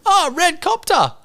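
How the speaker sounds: put-on very high, slow toddler exclaiming; toddler excited. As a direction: point and squeal like a baby. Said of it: "she's like".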